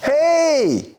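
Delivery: high call across the hall. Acted. Peter calling his friend across the hall, a high shouted hey.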